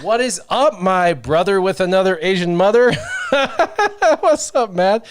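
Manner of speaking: big hyped hello to a viewer; excited shout-out. Real, not acted. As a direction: hype greeting to a viewer on stream, excited.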